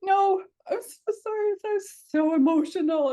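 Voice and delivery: high, loud, emotional apology, retold; starting to cry. Traveler apologizing to the lady beside her, near tears. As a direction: imitate apologizing while close to tears. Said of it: "I'm, like, starting to cry".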